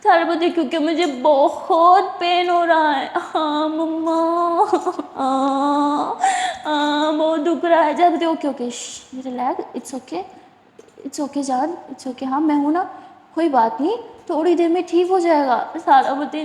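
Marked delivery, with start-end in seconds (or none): 0.5-8.0 higher, slow tearful whimper; crying in pain. Acted. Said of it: "सारा रोने लगती है". 8.2-15.6 soothing. Acted. Jaz soothing crying Sara.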